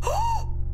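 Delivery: very high, loud "oh!"; high startled exclamation; shocked cry. Pretend. Shock at finding granny dead.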